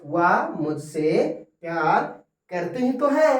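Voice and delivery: insist, she does love me; emphatic insistence. Shown as a demonstration. Teacher voicing emphatic love sentence. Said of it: "ये जोड़ डालकर बोल रहे हैं".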